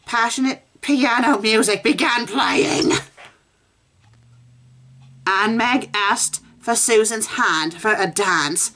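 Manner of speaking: high, dramatic reading voice; story reading. Acted. Reading the fanfic aloud, rising and dramatic. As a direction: read it like melodrama.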